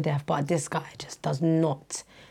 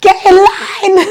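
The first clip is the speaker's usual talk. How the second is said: high yell with long drawn-out words; loud, slow teacher's holler; playground shout; 18 dB louder, much slower than usual. Retold or imitated. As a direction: bellow at the class, stretching it out. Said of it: "this is me shouting".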